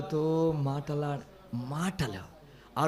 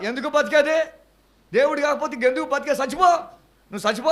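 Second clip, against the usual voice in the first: loud fiery challenge; 7 semitones higher, 7 dB louder, faster than usual. Retold or imitated. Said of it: "మీరు ట్రైనింగ్లో ఏం చెప్పారు తెలుసా".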